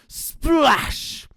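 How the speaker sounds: plain reading voice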